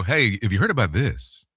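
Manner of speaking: soft, casual, one-to-one; friendly companion. Shown as a demonstration. Friend sharing a story with one listener, casual confiding manner. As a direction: share it like a friend in the passenger seat. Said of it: "the friend sitting in the passenger seat".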